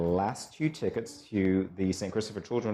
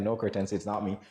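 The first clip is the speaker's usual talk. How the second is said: normal talking voice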